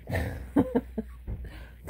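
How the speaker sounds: high, breath-only chuckle; laugh. Real, not acted. Speaker amused mid-rant, short high laugh. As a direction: burst into a short voiceless laugh.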